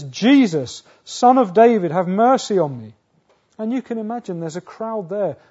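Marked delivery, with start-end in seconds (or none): 0.0-3.0 loud, raised cry for mercy; shouted plea. Acted. Blind beggar crying out to Jesus by the roadside, shouting. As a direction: call out over a crowd. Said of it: "he shouts out and he says".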